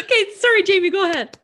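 high, loud, teary and laughing; laughing through tears. Real, not acted. Handing over to Jamie, laughing through tears. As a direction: still choked up, handing over. Crying after seeing friend.

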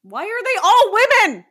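high, loud protest; indignant outburst. Real, not acted. Hosts joke about female assistant voices, loud indignant protest. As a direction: call out the all-female voices.

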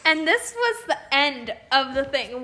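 ordinary talk, nothing marked

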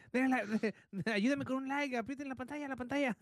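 high, pushy streamer begging for likes; TikTok live streamer. Pretend. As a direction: hype up the viewers like a livestreamer. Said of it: "Un live de TikTok".

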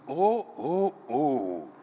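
lightly voiced admiring man's "oh"; a hint of an admiring man.

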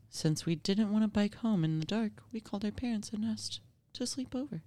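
ordinary delivery

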